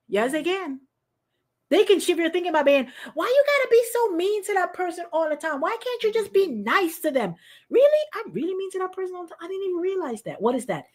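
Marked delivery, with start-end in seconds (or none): 3.1-7.4 higher-pitched, lightly voiced pleading kid; a hint of an earnest child asking why; child's challenge. Voicing a child challenging someone. 7.7-10.4 high, incredulous "really?" then softer; higher, softer dawning surprise; mock realization. Acting the surprised reply.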